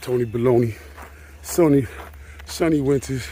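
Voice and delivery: normal talking voice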